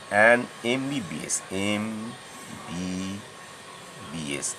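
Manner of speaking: "MBBS" spelled out very slowly, letter by letter; drawn-out MBBS. Shown as a demonstration. Teacher spells "MBBS" slowly for students. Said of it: "এমটা উচ্চারণ করতে হলে এম".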